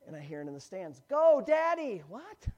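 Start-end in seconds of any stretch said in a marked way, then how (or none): 1.1-2.0 loud, high shout from the stands; joking crowd cheer for a bearded dad; mock kid cheering dad. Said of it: "I'm being facetious here". 2.1-2.5 high, rising baffled what; mock surprise. Real, not acted. Reacting to the fake cheer.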